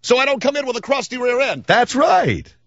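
high cartoonish mock voice; Comb Over Boy voice. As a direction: ask it in the silly high voice. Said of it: "you should say".

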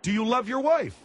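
high-pitched, insistent yell; yelled question. Acted. Host repeating the question to a guest who balked, yelling. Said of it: "Please don't yell at me".

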